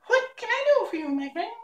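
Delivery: very high squeaky mouse queen; squeaky little voice. A narrator's reading. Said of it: "asked in her squeaky little voice".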